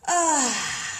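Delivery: loud, high open sigh; releasing breath out. Shown as a demonstration. Demonstrating a deep breath for viewers, loud sighed release. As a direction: let the breath go with a big audible Ah. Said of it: "Take a deep breath".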